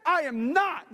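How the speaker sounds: high, loud comic protest; indignant denial. Acted. Peter denies being one of them, loud hammed-up indignation.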